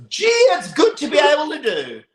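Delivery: high, loud mock enthusiasm; shouted, sarcastic fake cheer; sarcastic outburst. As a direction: exclaim it with biting sarcasm. Sarcastic outburst over cruelty.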